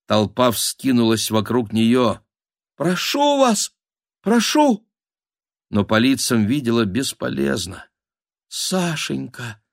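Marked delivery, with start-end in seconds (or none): 2.8-4.9 very high, thin begging; desperate pleading. Acted. Мольба перед напирающей толпой. 8.5-9.6 soft, high, drawn-out name; anguished thought. A narrator's reading. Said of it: "была единственная её мысль".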